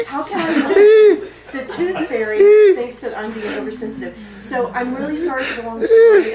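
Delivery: loud, high, laughing near tears; hysterical laugh. Acted. Beth breaks down laughing in front of the group, loud and high. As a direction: crack into a shrill laughing breakdown.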